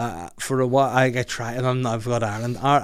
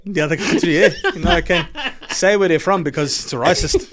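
higher South African accent, half laughing; 5 semitones higher, 5 dB louder than usual. Shown as a demonstration. Dodging the answer, laughing South African accent. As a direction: keep the mock South African going through giggles. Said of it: "I'll do my South African accent".

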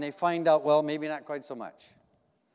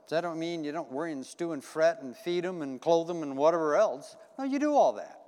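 ordinary talk

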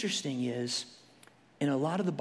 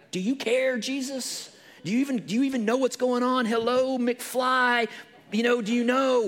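higher, fast, whiny challenge; mock exasperation; 8 semitones higher, 5 dB louder than usual. Believer complaining to Jesus, hammed-up exasperation. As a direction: sass God a little. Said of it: "and you're like".

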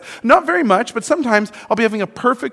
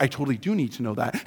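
much softer and quicker, hushed; sudden realization; 9 semitones lower, 12 dB quieter, faster than usual. Shown as a demonstration. Suddenly realizing the information matters, hushed. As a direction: drop the voice as it suddenly dawns on you. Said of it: "all of a sudden you're like".

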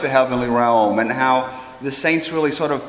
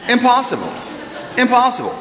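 indignant refusal; 9 semitones higher than usual. Retold or imitated. Italian crowd refusing to line up.

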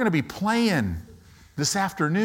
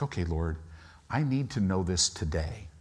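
low, soft, contained prayer; pleading under pressure; 10 semitones lower, 8 dB quieter than usual. Acted. Believer telling the Lord about a deadline, quietly insistent. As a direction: keep it hushed and low, pressing the need. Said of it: "you're just like".

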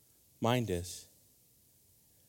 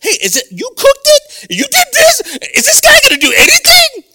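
much higher and louder, near tears of outrage; Martha-style indignation; 12 dB louder than usual. Acted. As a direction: fuss like the one left doing all the work. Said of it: "I was having a Martha moment".

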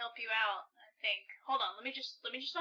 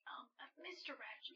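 hushed, hesitant approach; very soft, so your friend can't hear; confidential whisper; 14 dB quieter than usual. Acted. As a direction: tentatively whisper for attention. Starts private talk about friend.

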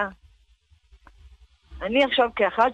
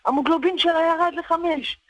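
retold urgent call; 6 semitones higher than usual. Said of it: "והאחות צועקת".

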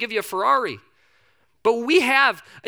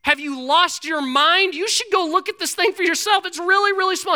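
high-pitched mock outrage; incredulous servant; 10 semitones higher, 4 dB louder than usual. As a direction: protest in a high, disbelieving voice. Servant disbelieves Elijah's order.

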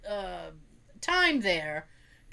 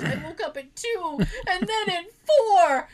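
laughing retelling; 8 semitones higher, 4 dB louder than usual. Retold or imitated. Mom annoyed, speaker laughing.

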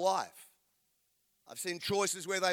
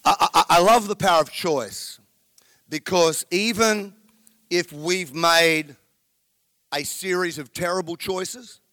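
intense whisper; 9 dB louder than usual. Real, not acted. Closing sermon point, breathy intensity.

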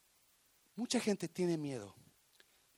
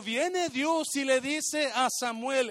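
ordinary talk

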